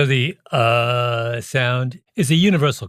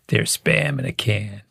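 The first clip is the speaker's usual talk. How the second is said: Yeager drawl; 2 semitones lower than usual. Retold or imitated. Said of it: "Yeager, played by Sam Shepard, says".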